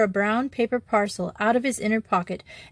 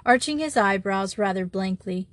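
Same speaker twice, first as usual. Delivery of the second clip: ordinary delivery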